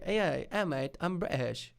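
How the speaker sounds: drawn-out mock-British accent; comic British accent, starting high. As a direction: ham up a British lad's accent. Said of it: "it sounds funny in British".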